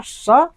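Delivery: teacher naming the sound स; स demo. Shown as a demonstration. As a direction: make स hiss clearly. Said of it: "उस चीज़ को बोलते हैं स".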